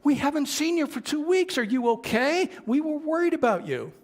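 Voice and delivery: put-on high, loud, eager children's voices; excited kids. Kids running up to the missing woman, excited and worried. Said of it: "they ran up to her".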